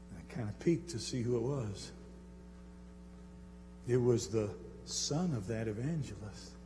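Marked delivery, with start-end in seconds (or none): none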